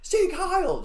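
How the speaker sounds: screamed German salute. Retold or imitated. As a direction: shout it like a ranting dictator. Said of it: "he just would stand up there and scream".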